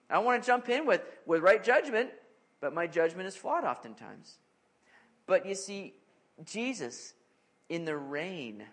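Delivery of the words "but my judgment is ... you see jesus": ordinary talk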